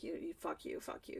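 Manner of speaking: very soft, low cursing; feeble retort. Retold or imitated. Wife answering dismissive husband, quiet feeble cursing. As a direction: curse under the breath, defeated.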